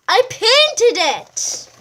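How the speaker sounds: high, loud and slow reveal; proud reveal. A narrator's reading. Mouse reveals she painted it.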